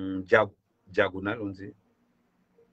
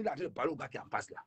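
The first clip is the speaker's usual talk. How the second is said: usual speaking voice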